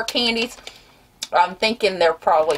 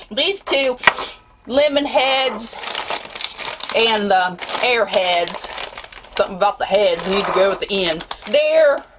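ordinary talk

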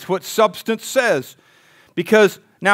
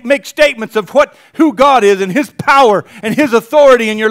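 louder, rising preaching; fired-up preaching; 6 semitones higher, 7 dB louder than usual. Acted. Pastor urges the church on, louder fired-up preaching. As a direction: build the fire under the church.

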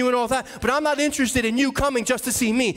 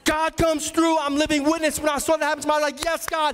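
rising preaching; 4 semitones higher than usual. Acted. Pastor to parents, preaching with rising fervor. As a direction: preach it higher with growing conviction.

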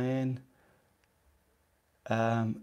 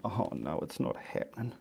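mock frustration; 8 dB quieter than usual. Imagining herself frustrated on a live stream, mock despair. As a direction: act out giving up on the painting, half-hearted. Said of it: "getting frustrated and going".

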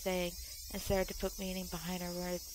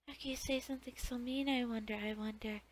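normal talking voice

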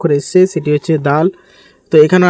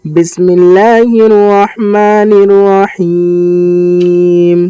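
slow melodic tajweed chant; measured Quranic recitation; 3 semitones higher, much slower than usual. Shown as a demonstration. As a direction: recite slowly, drawing out each vowel. Full recitation over music.

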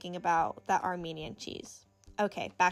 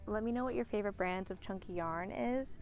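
ordinary delivery

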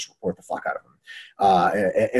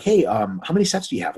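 casual polite ask; 7 semitones higher than usual. Acted. Asking a resting lifter about sets, casual and polite. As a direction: ease into the question politely. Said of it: "give people the breathing room".

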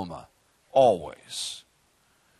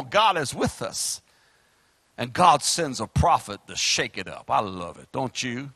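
ordinary delivery

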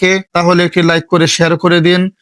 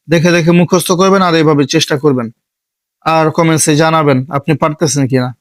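usual speaking voice

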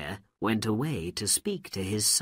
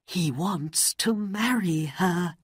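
humble pleading mother; 8 semitones higher, 3 dB louder than usual. Acted. Mother pleading to the Sultan for her lovesick son. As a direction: beg the king gently for your son.

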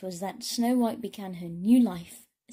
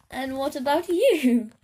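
louder, higher alien question; 5 semitones higher, 9 dB louder than usual. Acted. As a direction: boom it out as a cheerful alien.

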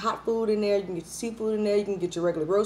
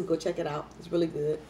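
normal talking voice

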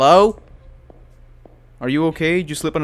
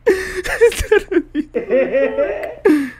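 nervous laughter breaking into whimpers; panicked giggle-sob; laugh-crying; 13 semitones higher, 4 dB louder, much slower than usual. Real, not acted. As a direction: laugh through the terror, slowly.

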